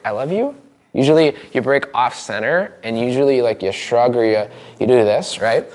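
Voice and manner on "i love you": higher, YOU lifting at the end; unsure question, "you" tipping upward; unconvincing rising question. Shown as a demonstration. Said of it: "there's a physiology that goes like".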